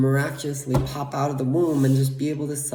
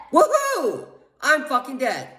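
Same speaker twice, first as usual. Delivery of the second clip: a sarcastic high whoop, then a bitter cheer; fake-happy whoop about being dead; mock celebration; 12 semitones higher than usual. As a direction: cheer it like a party, dripping with sarcasm. Bitter fake celebration of own death.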